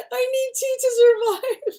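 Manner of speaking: cartoonish fake-crying waif; mock pitiful, laughing. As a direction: overdo the starving waif plea, giggling. Repeated, more hammed.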